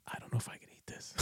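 quick whisper, no voice at all; host whispering his doubts to the camera, whispered quickly; whispered aside. Retold or imitated. Hushed rushed whisper to the camera.

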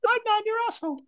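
very high squeal; squeaky mock yelp. Joking about cold water, squealing. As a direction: squeal it like the cold just hit.

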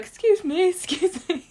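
put-on child Sam. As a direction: squeak it out like a polite kid. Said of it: "he's like".

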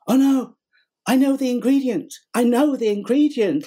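excited high exclamation. Retold or imitated. Excited man claims the ingredient.

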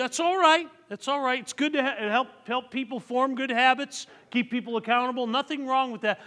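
put-on high, loud exhortation to the teenagers; energetic pulpit appeal; preaching at full force. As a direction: lift the voice and plead with the youth. Pastor addressing teenagers.

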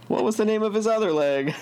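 high, fast punchline through laughter; embarrassing dad joke. Shown as a demonstration. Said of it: "that is such a dad joke".

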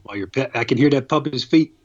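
ordinary talk, nothing marked

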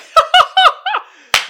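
loud high burst of laughter. Real, not acted. Laughs at own pun.

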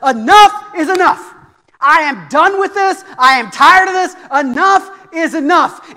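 loud, high, slow thundering declaration; fed-up divine command. Retold or imitated. God the Father done with the accuser, thundering. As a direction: punch each phrase with final authority.

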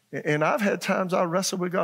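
ordinary talk, nothing marked

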